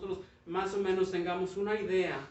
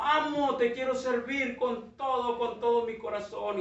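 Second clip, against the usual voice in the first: loud, higher cry of devotion; shouting to the master; 5 semitones higher, 4 dB louder than usual. Acted. Bamboo shouting its devotion to the master every morning, loud cry. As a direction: call up to the master with all your heart. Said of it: "le gritaba al amo".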